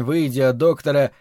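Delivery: ordinary delivery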